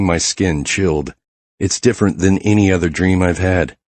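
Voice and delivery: plain reading voice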